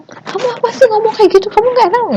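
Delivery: hammed-up petulant complaint; mock overreaction. Shown as a demonstration. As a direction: overreact as if offended. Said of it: "Itu kan beda".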